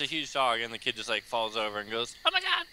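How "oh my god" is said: put-on kid voice.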